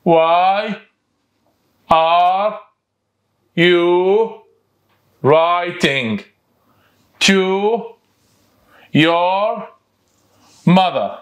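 each word alone, a pause after each; teacher dictating word by word. Shown as a demonstration. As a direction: say one word at a time, loud and clear. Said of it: "يلا".

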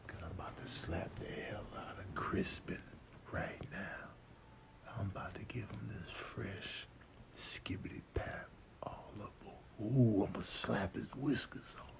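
unvoiced whispered threat. Cat whispering plans to slap Crispin. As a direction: whisper so the sleeper won't wake.

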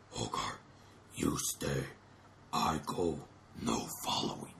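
very low, soft, whispery robot; robot giant voice. Retold or imitated. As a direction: rumble it out like a giant machine. Giant repeats boy's words.

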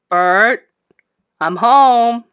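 loud call into the house. A narrator's reading. Wife calling out to husband on arriving home. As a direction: call out to the next room. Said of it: "Missus Brisbane called out".

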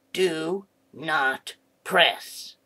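very slow, word by word; slow, separated words; slow warning. Acted. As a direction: spell out the warning with long pauses. Reads out a warning button.

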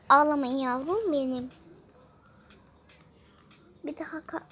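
ordinary delivery

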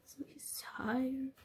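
soft slow whisper. Real, not acted. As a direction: whisper that he's tired. Whispered aside about tiredness.

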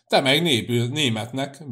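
loud burst of fury on the opening; enraged bellow. A narrator's reading. The priest flares up at Cecei's insult, enraged bellow. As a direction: bellow back in outrage. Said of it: "bődült, bőszült fel a pap".

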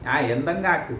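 normal talking voice

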